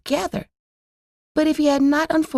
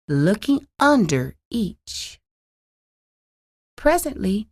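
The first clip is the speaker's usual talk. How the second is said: ordinary delivery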